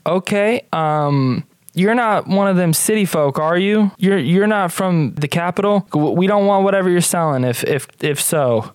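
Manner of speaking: high, hesitant country character voice; wary country farmer. Acted. Farmer asks if stranger is city folk, high and wary.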